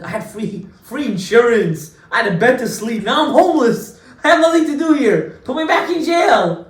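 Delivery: hammed-up begging to be locked up again; mock desperate plea. Prisoner begging the bailers to send him back, rising mock plea.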